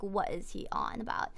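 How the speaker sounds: whispered puzzled aside; inner puzzled thought. Retold or imitated. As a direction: breathe it under your breath, baffled. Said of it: "looking at him like".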